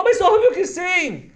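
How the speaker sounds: drawn out happy answer; delighted little girl. Acted. (menina aceitando, aguda e lenta)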